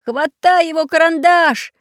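high, loud frantic shout; frantic yell. Acted. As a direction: yell it in panic, voice cracking high. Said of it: "не своим голосом закричал".

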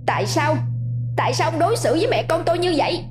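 put-on high, fast child's voice crying out in grief; a wronged boy hurling his pain at his father; anguished cry. Ghost son choking his father, high fast anguished cry. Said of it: "gào lên trong uất hận".

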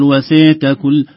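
"وسعت" with the ain clipped and overstressed; wrong pronunciation. Shown as a demonstration. Teacher shows the over-hard ain mistake. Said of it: "لا نقول".

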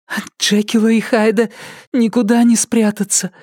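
high, airy thought voice; inner thought. A narrator's reading. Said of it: "вдруг подумала она".

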